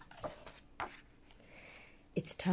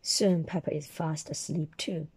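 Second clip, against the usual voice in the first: usual speaking voice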